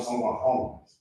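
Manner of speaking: normal talking voice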